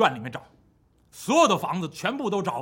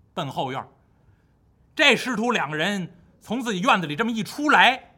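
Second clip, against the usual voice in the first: ordinary delivery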